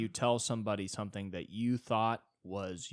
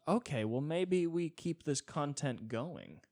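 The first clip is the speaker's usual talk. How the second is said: higher and drawn out, slowly mulling it over; mock slow musing; 6 semitones higher, slower than usual. Quoting the other guy's reply, mock slow musing. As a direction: voice the other guy lazily deciding, a bit higher.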